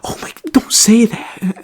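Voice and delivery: high, slow, hushed whisper; embarrassed parent hush. Retold or imitated. Parents whispering to scold their son in embarrassment. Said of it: "his parents are like".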